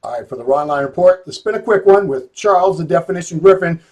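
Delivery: proud outro boom; host sign-off. Acted. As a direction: announce the new champion like a show host. Show host's sign-off.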